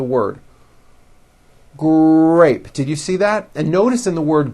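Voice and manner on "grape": "Grape" with lips forward and a held R; light G, long R in "Grape"; strong R blend. Shown as a demonstration. Teacher shows mouth coming forward, "Grape" with stretched strong R. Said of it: "notice how my mouth comes forward".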